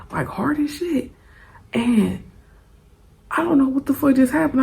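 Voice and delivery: normal talking voice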